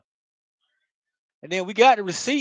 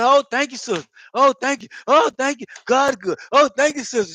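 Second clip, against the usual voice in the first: higher, louder gushing oh thank yous; over-the-top thanks; 4 semitones higher, 4 dB louder than usual. Retold or imitated. Thanking the sister again and again, fawning. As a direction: overdo it, fawning. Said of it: "If I go to".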